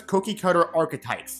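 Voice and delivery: usual speaking voice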